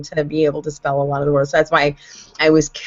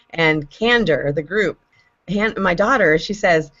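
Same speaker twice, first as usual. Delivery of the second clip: ordinary talk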